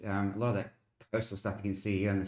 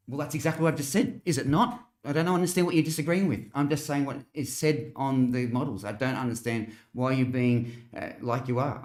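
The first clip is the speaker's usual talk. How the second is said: raised, higher-pitched voice, arguing back; exasperated; 5 semitones higher, 5 dB louder than usual. Real, not acted. Streamer answering a commenter who disagrees with the forecast, exasperated. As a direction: push back in real exasperation, loud and high.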